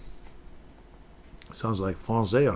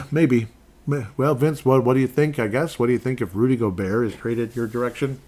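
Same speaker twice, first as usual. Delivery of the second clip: ordinary talk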